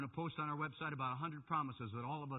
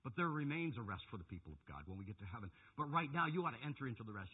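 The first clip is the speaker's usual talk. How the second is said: normal talking voice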